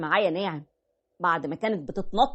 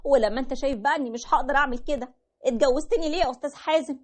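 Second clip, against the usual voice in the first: higher, mocking wife voice; sarcastic wife; 5 semitones higher than usual. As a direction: voice the wife throwing it back at him. (الزوجة بتتريق بصوت أعلى)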